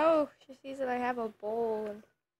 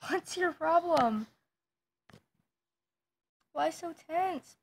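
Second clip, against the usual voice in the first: higher, slow teasing coo at the dog; 6 semitones higher, 5 dB louder than usual. Pretend. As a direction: tease the grumpy puppy gently and slowly.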